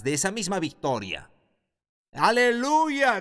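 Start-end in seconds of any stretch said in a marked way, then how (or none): 2.1-3.2 high, loud, slow cry of praise; joyful shout. Real, not acted. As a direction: let the hallelujah ring out. Estallido de alabanza. (grito de júbilo, alto y lento)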